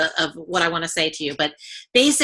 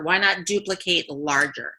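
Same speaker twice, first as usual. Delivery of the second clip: normal talking voice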